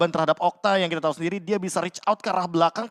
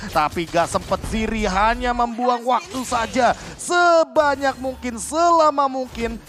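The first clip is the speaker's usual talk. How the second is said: screaming play-by-play, much higher; esports caster; 8 semitones higher, 5 dB louder than usual. Real, not acted. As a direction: yell the big play at full hype. Hyped live caster call.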